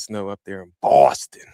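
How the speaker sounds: put-on amateur go at a Boston accent; attempted Boston accent. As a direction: try a broad Boston vowel on BOSTON. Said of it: "you got that Boston accent".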